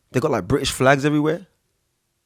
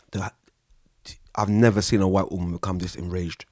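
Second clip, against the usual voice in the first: ordinary talk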